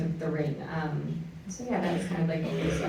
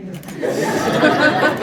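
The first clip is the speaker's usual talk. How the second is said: loud, high burst of laughter; 11 semitones higher, 11 dB louder than usual. Real, not acted. Group laughs at song joke.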